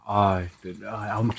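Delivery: soft, halting nervous stammer; imitating being lost for words; tongue-tied. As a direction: fumble quietly for the words. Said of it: "I'm trying to get the words out".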